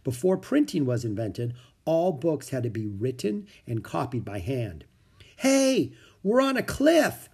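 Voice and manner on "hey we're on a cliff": high, loud excited call. A narrator's reading. Annie calls to Jack from the cliff outside, calling out. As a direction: shout the news from outside. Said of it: "Annie called from outside".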